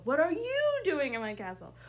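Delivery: "you" stretched high and loud; stress on you. Shown as a demonstration. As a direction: throw all the weight on "you", falling after. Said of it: "pick one word in that sentence to really emphasize".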